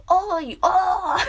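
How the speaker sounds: loud, high drawn-out calls; cute mock call-out. Streamer answering her own actress bit, loud mock call. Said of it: "可愛い声とかさ".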